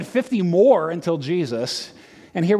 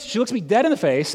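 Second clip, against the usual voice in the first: normal talking voice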